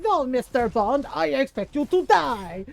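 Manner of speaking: slow, loud, menacing villain line; hammed-up evil mastermind; Bond villain. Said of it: "He sounds like a fucking Bond villain".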